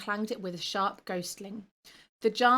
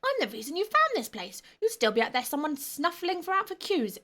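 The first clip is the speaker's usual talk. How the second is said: furious little ghost stamping his feet; raging goblin ghost; 8 semitones higher than usual. Acted. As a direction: throw a little tantrum. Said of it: "jumped up and down with rage".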